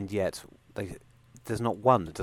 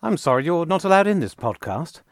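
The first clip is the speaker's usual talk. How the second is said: higher, louder, haughty Doctor; indignant old-school gentleman; posh Doctor voice; 9 semitones higher, 7 dB louder than usual. Acted. Skit, plays the Doctor.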